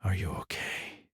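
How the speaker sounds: soft whispered question. A narrator's reading. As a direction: ask in a hushed whisper. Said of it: "he said softly".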